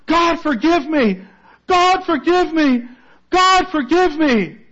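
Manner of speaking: high, loud pleading, building; crying out for forgiveness. Retold or imitated. Guilty prisoner begs God to forgive him, loud pleading. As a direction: beg God over and over for mercy. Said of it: "he cried out".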